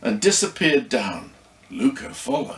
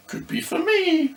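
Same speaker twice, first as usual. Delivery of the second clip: put-on a touch higher, playful child's voice; Esme, lightly voiced girl. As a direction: chirp it like a young girl teasing. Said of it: "said Esme".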